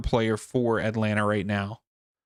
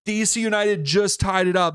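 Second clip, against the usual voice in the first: excited; 7 semitones higher, 4 dB louder than usual. Real, not acted. Repeating goal news.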